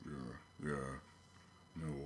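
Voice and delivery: low, soft grunted mumble; teenage grunt. Retold or imitated. Father imitating his eighteen-year-old son, low grunted reply. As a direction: grunt it like a teenager who won't talk. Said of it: "He doesn't actually talk so much as he grunts".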